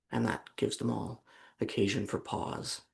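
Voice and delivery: ordinary talk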